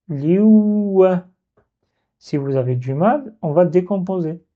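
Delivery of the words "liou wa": "Liou wa" with a long held "ou", higher and louder; "Liou wa" stretched as "liouuu-wa"; blocked waw. Shown as a demonstration. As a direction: hold "Liou" before releasing "wa". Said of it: "je bloque le waw".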